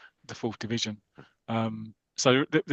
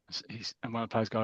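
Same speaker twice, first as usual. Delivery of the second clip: usual speaking voice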